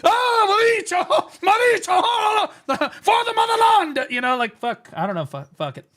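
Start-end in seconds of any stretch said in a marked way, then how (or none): 0.0-4.1 high hammed-up shouting, laughing at the end; mock Russian battle yell. Streamer imitates Russian players freaking out, mock shouting. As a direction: yell it like a fired-up Russian gamer. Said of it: "the Russian language gasses me up".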